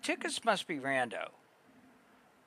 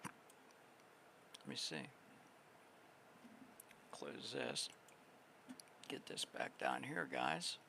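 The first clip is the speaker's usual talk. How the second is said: quiet; 5 semitones lower, 19 dB quieter, slower than usual. Real, not acted. Muttering to self.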